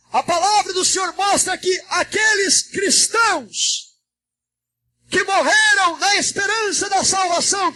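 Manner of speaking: high, sustained shouted oratory; full-force preaching. Real, not acted. Pastor preaching the last trumpet to the church, high fervent shout. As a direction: proclaim it urgently, phrase upon phrase.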